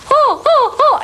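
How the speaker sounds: very high oh oh oh; monkey call. Retold or imitated. Retelling a monkey's call from the zoo, very high. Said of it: "out of the blue comes".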